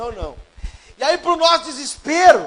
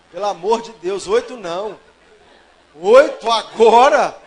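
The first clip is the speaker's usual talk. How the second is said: loud, slow, laughing protest at the number; exasperated outburst; 4 semitones lower, 5 dB louder, slower than usual. Real, not acted. Groom dreading a long wedding sermon, loud laughing protest. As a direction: groan out the disbelief with a laugh. Dismay at eight wedding points.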